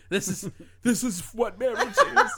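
giggling through the words; laughing. Retold or imitated. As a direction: laugh while saying it. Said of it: "And I was like".

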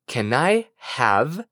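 drawn-out English phrase, rising at the end; slow repeat-after-me model. Shown as a demonstration. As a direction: say it slowly for a learner to copy. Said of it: "Repite conmigo". (modelo lento para repetir)

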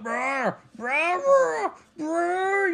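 high, drawn-out mock creature roar; comic high-pitched growling wail; imitated monster roar. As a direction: roar like a creature mid-transformation.

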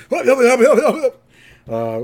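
high, loud garbled sputter; confused waking-up babble. Retold or imitated. Imitating confused old people waking up, confused babbling. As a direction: babble like someone startled out of sleep. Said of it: "confused old people waking up".

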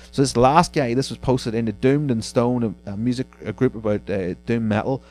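normal talking voice

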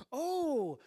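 high, drawn-out mock amazement; loud swooping dad's "oh, wow"; trying to sound excited. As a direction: put on enthusiasm for a child's gift. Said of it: "trying to, like, get excited about it".

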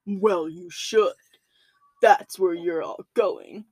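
low, slow demonic voice; satanic voice. Retold or imitated. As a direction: drag it out like a demon. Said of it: "That's not really scary, but it was a good try".

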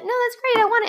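high, bright fake sincerity; mock innocent. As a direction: play it overly sweet and innocent. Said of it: "he's like".

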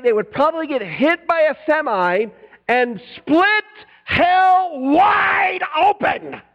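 building from slow high preaching to a crying shout; hellfire preaching. Shown as a demonstration. Preacher warning a stranger of hell, building to shout. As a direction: preach it to a stranger on his porch, rising to a roar. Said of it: "You gotta say it like that".

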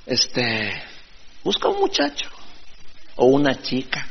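teasing mimicry of the influencer; mock influencer advice. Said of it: "le dicen".

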